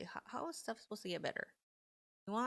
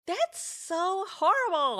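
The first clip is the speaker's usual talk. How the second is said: outraged cry; 10 semitones higher, 12 dB louder, slower than usual. Real, not acted. Reacting with outrage to restriction.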